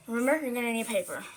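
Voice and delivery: ordinary talk, nothing marked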